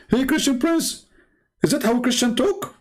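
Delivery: high-pitched mimicry of a challenger; high mock reproach, rising at the end; mocking scolding critic. Imitates his critics' challenge.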